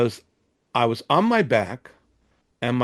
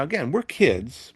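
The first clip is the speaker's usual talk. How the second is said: ordinary talk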